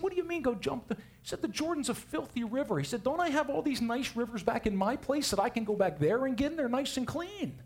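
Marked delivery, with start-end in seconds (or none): 0.0-0.9 much higher, outraged disbelief; a proud general insulted by the order; indignant. Acted. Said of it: "Naaman was indignant". 1.4-2.8 much higher, outraged disbelief; a proud general insulted by the order; indignant. Acted. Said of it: "Naaman was indignant". 3.0-7.6 much higher, outraged disbelief; a proud general insulted by the order; indignant. Acted. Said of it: "Naaman was indignant".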